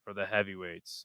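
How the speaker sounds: slow, hushed golf announcer; soft and slow like golf coverage. Pretend. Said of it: "I gotta start talking like a golf annou".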